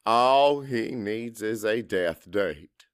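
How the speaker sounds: usual speaking voice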